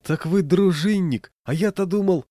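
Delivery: high-pitched, slowed astonishment; exclaiming. A narrator's reading. Walker realises the bandit is a patrolman, exclaiming. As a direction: let the pitch soar with amazed relief. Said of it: "воскликнул я".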